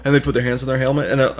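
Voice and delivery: ordinary talk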